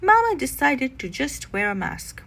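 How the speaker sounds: ordinary delivery, nothing marked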